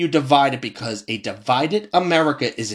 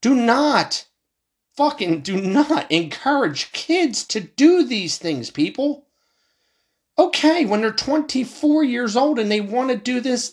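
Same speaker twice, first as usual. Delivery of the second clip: higher, slow, pleading rant; impassioned plea; 10 semitones higher than usual. Real, not acted. Vlogger urging people not to encourage kids, higher and impassioned. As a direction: beg them to stop, voice up high.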